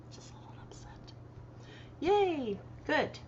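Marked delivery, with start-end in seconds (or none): 0.1-1.1 breathy whisper, half to herself; whispered aside. Real, not acted. To her viewers, admitting she was upset, in a whisper. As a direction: let it slip out as a whisper.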